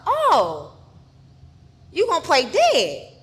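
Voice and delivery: loud challenge, rising high; menacing taunt. Retold or imitated. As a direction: taunt someone you have caught faking. Retells attacker's taunt.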